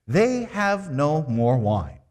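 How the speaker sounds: slow, simple, even statement; high, slow plain statement of the problem; very simply. Shown as a demonstration. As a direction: lay it out simply and slowly. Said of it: "Very simply".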